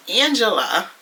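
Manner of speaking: usual speaking voice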